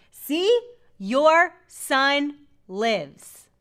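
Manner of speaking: high, loud, drawn-out gloating; hammed-up prophet proving his point, very slow; mock told-you-so. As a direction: stretch each word in playful triumph. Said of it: "I told you so".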